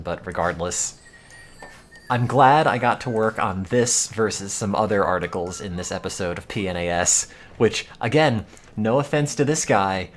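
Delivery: ordinary talk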